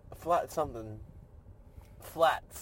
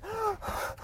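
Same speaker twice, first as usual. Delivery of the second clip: high, sharp gasp for air; demonstrative high-pitched gasp; out-of-breath gasp; 6 dB quieter than usual. Shown as a demonstration. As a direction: mimic being totally winded. Acts out being max out of breath.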